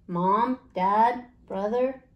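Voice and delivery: plain reading voice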